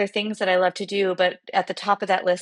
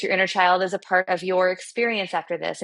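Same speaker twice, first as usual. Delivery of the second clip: ordinary talk, nothing marked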